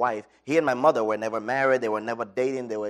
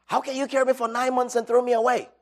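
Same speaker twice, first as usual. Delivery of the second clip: much higher, louder pained accusation; angry demand; 13 semitones higher, 5 dB louder than usual. Retold or imitated. Abandoned son confronts his mother, angry and much higher. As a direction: hurl the question at her like a wound reopening. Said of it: "And I got angry".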